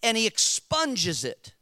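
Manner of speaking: loud, high-pitched sermon delivery; preaching at full force. Real, not acted. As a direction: drive each phrase home to the congregation.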